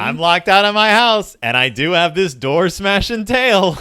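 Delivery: high, loud, hammed-up outrage; mock indignant new mother. Playing the new mother locked out of her house, loud mock outrage. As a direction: ham it up as the fed-up monster mother.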